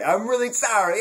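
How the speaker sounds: a hammed-up Brooklyn wiseguy apology; Brooklyn tough guy. Mock apology to his match, hammed-up Brooklyn accent. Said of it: "Vinny from Brooklyn".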